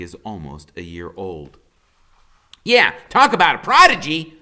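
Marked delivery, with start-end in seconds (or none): 2.6-4.4 put-on high, loud wisecracking boy; Freak's high smart-aleck voice. A narrator's reading. Boy joking about his own birthday, high and cocky. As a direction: crack the joke like a cocky kid. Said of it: "Freak says".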